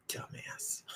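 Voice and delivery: breathy whispered Dumbass; whispered insult. Pretend. Muttered insult after joke.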